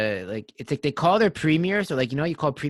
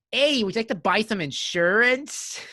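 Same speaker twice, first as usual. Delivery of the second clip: higher-pitched mock Canadian accent, lifting at the end; 6 semitones higher than usual. Pitching insurance to sir and ma'am in an exaggerated Canadian accent. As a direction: put on a keen Canadian salesman's accent. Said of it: "in a Canadian accent".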